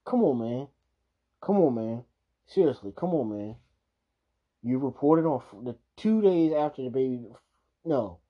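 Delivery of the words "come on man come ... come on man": loud, pitched-up disbelief; ranting as if it were him; imagining himself as the parent, loud and incredulous; exasperated outburst. Pretend. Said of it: "if it was me, it would've been like".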